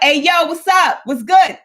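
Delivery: high, punchy holler of a greeting; rough street greeting. Retold or imitated. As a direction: call it out hard and casual. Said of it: "sometimes I can be rough".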